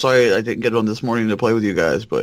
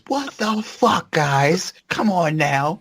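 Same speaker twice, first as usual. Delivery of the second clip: high, slow exasperated boss voice; a fed-up manager losing it; 11 semitones higher, much slower than usual. Pretend. Role-plays an exasperated boss.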